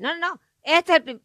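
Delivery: high, loud eager protest; insisting it's the first single; insistent blurt. Retold or imitated. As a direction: cut in excitedly, won't be stopped. Insisting again, cut off.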